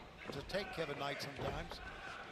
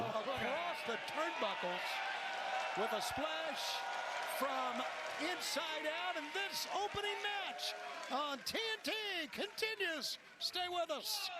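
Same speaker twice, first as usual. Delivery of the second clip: wrestling commentator; 11 semitones higher, slower than usual. Real, not acted. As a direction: hype the finish like live TV. Hyped TV wrestling call.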